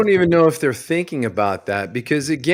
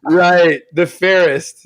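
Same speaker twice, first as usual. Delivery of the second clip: sarcastic; 3 semitones higher, 4 dB louder, much slower than usual. Retold or imitated. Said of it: "I'm like".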